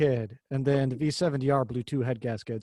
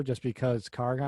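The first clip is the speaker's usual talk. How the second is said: ordinary talk, nothing marked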